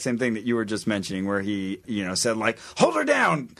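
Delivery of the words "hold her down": much higher commanding preacher voice; hammed-up preacher orders; preacher impression. Quoting the preacher.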